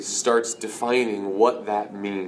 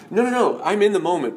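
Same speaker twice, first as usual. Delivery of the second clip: higher, urgent interrupting objection; protesting Peter; 8 semitones higher, 4 dB louder than usual. Acted. Peter objecting to Jesus' plan to die, acted protest. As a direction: cut in with a high-pitched protest. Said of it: "And Peter says".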